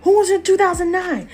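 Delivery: wife yelling at the two-timing husband, teary and furious. Retold or imitated. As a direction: scream it at the cheating husband.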